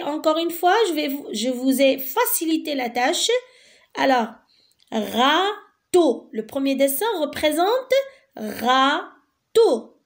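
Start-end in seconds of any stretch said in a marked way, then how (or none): 4.9-6.3 râteau split into râ... teau; syllable by syllable. Shown as a demonstration. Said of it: "Deux syllabes". 8.4-10.0 râteau split into râ... teau; syllable by syllable. Shown as a demonstration. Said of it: "Deux syllabes".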